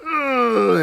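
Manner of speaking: straining grunt. Retold or imitated. Straining to pee.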